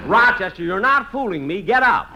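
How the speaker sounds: loud, insistent wake-up scold; loud scolding call. Acted. Jack ordering Rochester out of bed, loud scolding. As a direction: rouse him with a loud scold.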